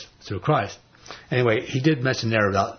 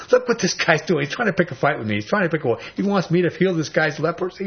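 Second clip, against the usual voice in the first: raised, higher indignant protest; ticked off; 6 semitones higher than usual. Retold or imitated. King of Israel reading the letter, raised angry protest. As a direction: huff it out like an offended king. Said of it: "this king got all ticked off".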